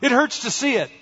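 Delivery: high-pitched, pained complaint; crying out. A narrator's reading. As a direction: cry out high in protest. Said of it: "they cried".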